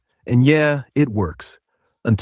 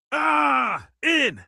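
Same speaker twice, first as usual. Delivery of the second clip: void scream; 14 semitones higher than usual. Pretend. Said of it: "Let's do Scream into the Void Unhinged".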